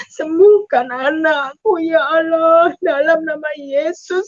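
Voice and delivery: slow, wailing plea for healing; sobbing-toned petition; tearful prayer. Retold or imitated. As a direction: beg through tears, stretching each phrase. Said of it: "Saya menangis".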